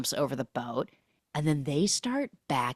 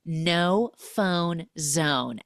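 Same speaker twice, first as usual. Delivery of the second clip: drawn-out, each word spaced apart; slow announcement; much slower than usual. Retold or imitated. Cam collecting phones on the boat, slow proclamation. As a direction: lay down the rule very slowly. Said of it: "and she goes".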